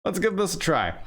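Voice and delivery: ordinary delivery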